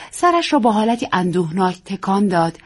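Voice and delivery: plain reading voice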